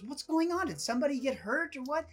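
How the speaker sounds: high, fast crowd chatter; nosy bystanders asking over shoulders; imitating alarmed onlookers. Onlookers peering over each other's shoulders, urgently curious.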